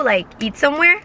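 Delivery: usual speaking voice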